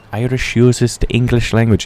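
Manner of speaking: badly faked Irish brogue; hammed-up, clumsy Irish accent; terrible Irish accent. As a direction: put on a bad Irish accent. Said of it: "Yeah, that's a terrible accent".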